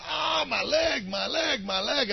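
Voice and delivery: high mock wail of pain; crying out in pain. Preacher retelling a movie, injured soldier mock wailing. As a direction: whimper it like a hurt soldier. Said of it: "he's crying out".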